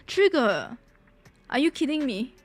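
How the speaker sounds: ordinary talk, nothing marked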